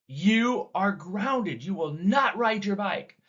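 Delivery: mock yell at the son, stretched slow; lightly screamed, words drawn out; retold scream. Mother retelling how she screamed at her son, mild mock yell. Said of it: "I screamed at him".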